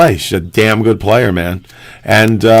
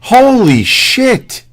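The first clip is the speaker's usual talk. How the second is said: high, drawn-out disbelief; exasperated; 11 semitones higher, much slower than usual. Real, not acted. Host fed up with the naysayers, exasperated and incredulous. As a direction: draw it out slowly, pitched way up.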